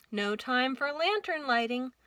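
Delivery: ordinary delivery, nothing marked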